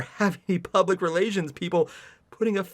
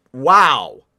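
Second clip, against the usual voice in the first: mock awe; 6 semitones lower, 7 dB louder than usual. Reads the heroine's gasp.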